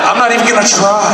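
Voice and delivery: high-pitched cocky shout; trash-talk shout. Retold or imitated. Father bragging to his son before the game, shouted trash talk. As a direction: shout it as a hammed-up boast.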